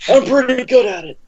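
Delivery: pushing the manic Cage voice; Nicolas Cage impression. Bragging to friends in his Nicolas Cage voice. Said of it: "That was a pretty good Nicolas Cage".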